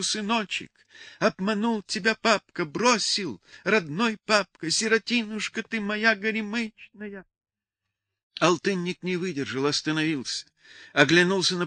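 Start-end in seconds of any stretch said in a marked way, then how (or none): 0.0-7.2 high-pitched singsong lament; keen over an abandoned child; wailing cry. A narrator's reading. Mother laments to her abandoned baby, wailing. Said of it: "закричал Людмила свёртку".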